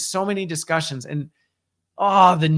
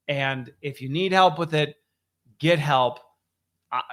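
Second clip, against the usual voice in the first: ordinary delivery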